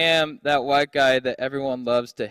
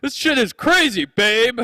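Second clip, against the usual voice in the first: mock bro filming; 6 semitones higher, 7 dB louder than usual. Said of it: "the guy's like".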